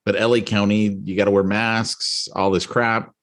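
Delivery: normal talking voice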